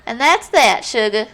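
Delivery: sweet Southern belle drawl; Southern accent. Acted. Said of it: "so stereotypical Southern".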